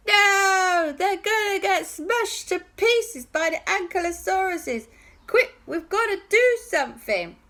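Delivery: put-on high, pressing child voices; urgent boys. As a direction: rush to save friends. Boys urge a rescue.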